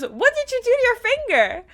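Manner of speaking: high, imitated alarm; shocked question. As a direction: demand to know how they got hurt. Retold friend's alarm at injured finger.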